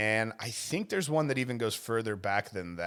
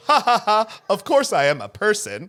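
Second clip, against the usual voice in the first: high, loud canned robot laugh; fake-cheerful robot phone voice; robocall voice; 8 semitones higher, 8 dB louder than usual. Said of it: "the robot responds with".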